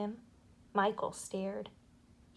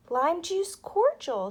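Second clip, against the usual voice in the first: slow, higher, louder savouring; pleased girl; 7 semitones higher, 8 dB louder, slower than usual. A narrator's reading. Jane tasting her dose, slow and pleased. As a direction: relish the taste like a girl.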